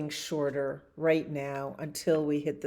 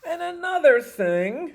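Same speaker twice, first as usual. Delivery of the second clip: louder, higher indignant outburst; pigeon outburst; 12 semitones higher, 10 dB louder than usual. Acted. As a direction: burst in with a fresh complaint. Pigeon ranting.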